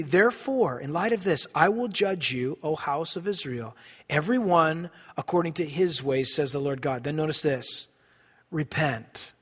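low, measured scripture reading; solemn preacher reading from the Bible. Acted. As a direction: read the verse slowly and gravely. Reads scripture aloud, low and slow.

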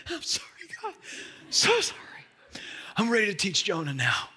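sheepish apology. Retold or imitated. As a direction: play a humbled, embarrassed self. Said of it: "I was like".

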